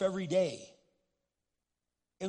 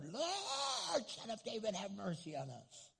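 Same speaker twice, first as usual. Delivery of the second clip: mild pleading cry; 3 semitones higher, 7 dB quieter, slower than usual. Retold or imitated. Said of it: "they only shouted louder".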